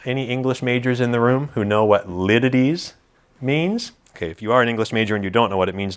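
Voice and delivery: usual speaking voice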